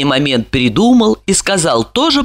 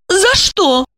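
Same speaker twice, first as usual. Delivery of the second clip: high puzzled question; high questioning female voice; surprised; 12 semitones higher than usual. A narrator's reading. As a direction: ask in surprise. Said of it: "Она удивилась".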